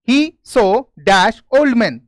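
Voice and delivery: very slow, word by word; slow dictation. Shown as a demonstration. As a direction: read it out slowly for the class to copy. Reading a fill-in sentence aloud. (धीमी, शब्द-दर-शब्द पढ़ाई)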